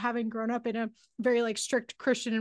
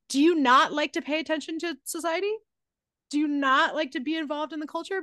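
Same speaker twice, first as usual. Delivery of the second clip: higher-pitched mock disbelief; incredulous retort; 4 semitones higher, 3 dB louder than usual. Woman answering men who dismiss internet culture, incredulous retort. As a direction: pointedly question them, as if baffled.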